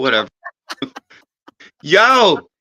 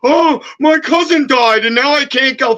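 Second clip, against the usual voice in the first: higher, mock-pitiful scammer voice; mocking whine; 6 semitones higher than usual. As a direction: parody a fake sob story, sneering. Mocking scammer's sob story.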